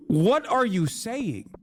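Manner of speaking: high, slow disbelief; what are you saying, baffled and high; incredulous. Real, not acted. Incredulous at Western media.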